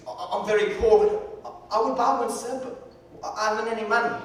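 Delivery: put-on poor orphan boy. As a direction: play the ragged orphan boy.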